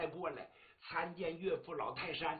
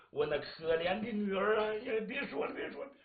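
raised, aching cry that fades; pained relenting; 4 semitones higher than usual. Acted. Father stopping his daughter's suicide, pained relenting. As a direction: give in with a tender lament.

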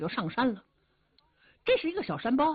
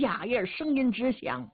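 plain reading voice

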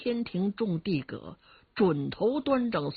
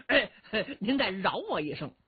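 usual speaking voice

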